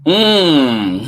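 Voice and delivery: ordinary delivery, nothing marked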